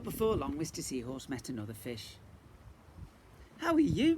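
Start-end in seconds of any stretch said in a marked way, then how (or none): 3.6-4.2 much higher, louder hello; cheery high greeting. Acted. Mister Seahorse greets a fish, cheery high hello. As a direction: call out brightly. Character greeting in picture book.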